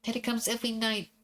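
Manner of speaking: put-on lightly high, pleading child; a small girl begging to be believed; little girl voice.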